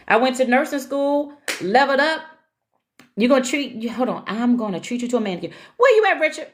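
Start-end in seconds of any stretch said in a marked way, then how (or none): 5.8-6.5 calling out. Real, not acted. Calling out to a viewer on the live.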